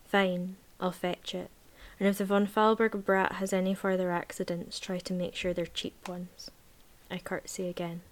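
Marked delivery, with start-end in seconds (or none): none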